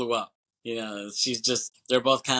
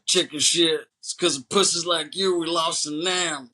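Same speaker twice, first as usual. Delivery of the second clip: louder, higher imitation of a jeering barfly; mock drunk heckler; 6 semitones higher, 4 dB louder than usual. Podcaster retelling the drunk insulting the soldier, mock drunk heckler. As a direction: taunt him like a hammed-up bar drunk. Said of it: "the drunk bastard, he's like".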